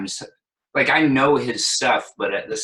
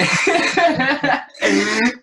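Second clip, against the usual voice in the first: high laugh; 6 dB louder than usual. Real, not acted. Laughs after mentioning Hamilton.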